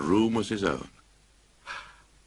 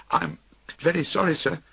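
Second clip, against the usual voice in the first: high, nervous clerk; frightened apology; 11 semitones higher than usual. Acted. Bob apologises to his boss, frightened. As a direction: afraid for his job. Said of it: "in terror of losing his job".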